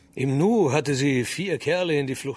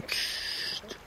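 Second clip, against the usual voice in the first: sharp shush; 9 dB quieter than usual. Acted. Hushing the girl on the bank.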